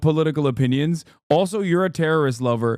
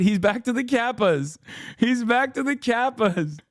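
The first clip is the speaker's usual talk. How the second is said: high, gleeful announcement; high gleeful shout of joy; delighted; 10 semitones higher than usual. Real, not acted. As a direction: crow it with delight. Delighted about chatter.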